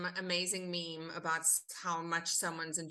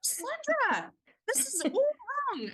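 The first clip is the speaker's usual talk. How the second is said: very high, loud, incredulous protest; hammed-up squeal of disbelief; mock outrage; 6 dB louder than usual. Actor replaying her reaction to being offered Carla, mock outraged. Retold outburst at wrong casting.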